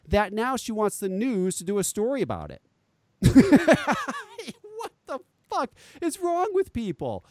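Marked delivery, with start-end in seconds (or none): 3.2-4.7 a high burst of laughter; loud disbelieving laugh. Real, not acted. Host laughing at the mom's demand for news coverage.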